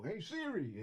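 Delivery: loud high call to the phone; calling Siri. Retold or imitated.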